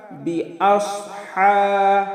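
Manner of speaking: বিআসহা chanted slowly, final হা held long; one-alif elongation. Shown as a demonstration. Teacher shows the madd, হা drawn out one alif. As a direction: stretch the হা on one level note. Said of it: "হাতে এক আলিফ টান".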